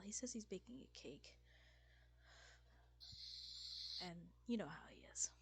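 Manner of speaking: hushed whisper. Acted. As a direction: whisper it aside. Whispered aside about Lord Bernal.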